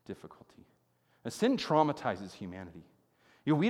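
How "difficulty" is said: whispered. Acted. Somber list of hardships.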